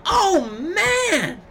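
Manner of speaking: high, stretched cry of delight; excited exclaim. Retold or imitated. Imitating Renee Young's high, thrilled exclaim at a big move. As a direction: mimic the commentator's thrilled yelp. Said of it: "she is right there going".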